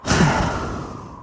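a long, weary sigh out through the mouth; deep sigh. A narrator's reading. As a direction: let out one heavy sigh of resignation. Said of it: "Elle poussa un profond soupir".

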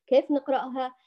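normal talking voice

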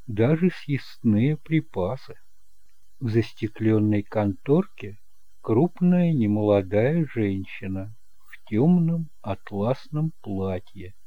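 usual speaking voice

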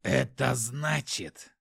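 slow, menacing demon voice; the demon turns threatening. A narrator's reading. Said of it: "Голос демона внезапно стал не менее грозным".

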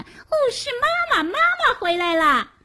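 put-on high, excited little girl's cry; happy child shout. A narrator's reading. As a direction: squeal with joy that mother is back. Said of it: "高兴地把头抬起来喊".

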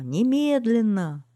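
loud, high, stretched-out command; crowd shouting. A narrator's reading. Crowd demanding action at once, shouting. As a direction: draw the word out in a shout. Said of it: "закричала толпа".

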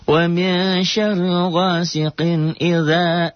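high, slow chanted recitation; Quran recitation demo. Shown as a demonstration. Teacher chanting the verse for students, slow and high. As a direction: draw out the Arabic like a reciting teacher. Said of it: "za-nya matobi".

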